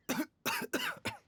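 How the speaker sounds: a short dry cough. Acted. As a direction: cough briefly, like a sickly man. Frail doctor coughs after speaking.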